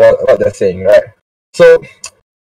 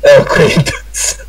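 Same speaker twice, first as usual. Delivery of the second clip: laughing echo; much slower than usual. Shown as a demonstration.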